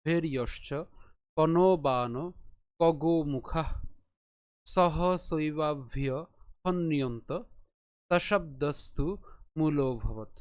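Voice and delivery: slow chanted Sanskrit verse; shloka recitation. Shown as a demonstration. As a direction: intone each word of the verse slowly. (ধীর শ্লোক পাঠ)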